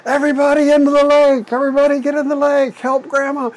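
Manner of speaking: high, loud, fast panicked hollering. Retold or imitated. Mother warning family of charging cows, loud fast holler. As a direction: yell to get everyone into the water now. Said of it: "she started hollering".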